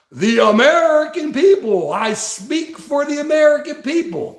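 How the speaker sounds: mock the pompous speech-maker; mocking politician. Mocking politicians who claim to speak for voters. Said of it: "they say".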